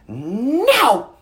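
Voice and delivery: mock defiant yell, high-pitched; imitated toddler yell. Two-year-old defying her dad, high mock yell. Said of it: "she will stiffen up and yell".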